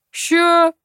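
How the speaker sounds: high, quick shout in reply; calling back. A narrator's reading. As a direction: call back as if from afar. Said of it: "последовал ответ". (крикнуть в ответ издалека)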